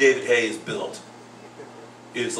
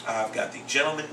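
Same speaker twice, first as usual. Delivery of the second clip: show announcer; 4 semitones higher than usual. Acted. Host introduces fighting school teacher to listeners, announcer style. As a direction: announce the guest like a ring announcer.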